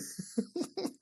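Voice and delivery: high, quiet snicker; real suppressed laugh; soft giggle. Real, not acted. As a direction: giggle under the breath at it. Laughs at the gif.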